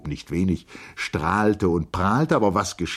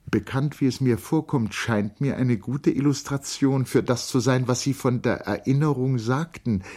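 higher-pitched woman's dialogue in narration; Charlotte; 6 semitones higher than usual. A narrator's reading. Charlotte questions August about the guest's departure, lightly voiced in higher pitch. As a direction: lift the pitch for her polite inquiry.

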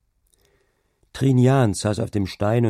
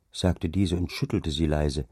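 ordinary delivery, nothing marked

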